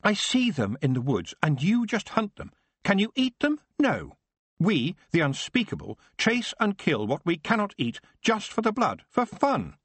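put-on high, indignant boy's voice; a boy finding his courage; defiant Geoffrey. Son condemning the hunt to his father, high and indignant.

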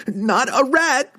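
very high panicked squeal; terrified shriek. A narrator's reading. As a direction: shriek it in terror. Thief shrieking again.

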